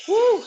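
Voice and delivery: excited whoop. Real, not acted. Cheering at end of set.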